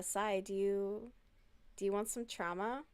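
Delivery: ordinary talk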